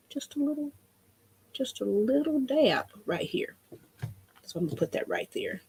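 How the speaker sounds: dropping to a whisper for the careful dab; soft concentrated near-whisper. Real, not acted. Crafter whispers while dabbing paint carefully, hushed.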